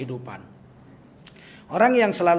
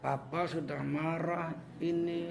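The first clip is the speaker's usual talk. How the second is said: slow, much softer, held-in anger; quiet angry father; 2 semitones lower, 9 dB quieter, slower than usual. Retold or imitated. Father warning his child he is angry, quiet slow menace. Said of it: "malah pelan".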